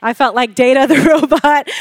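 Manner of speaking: ordinary talk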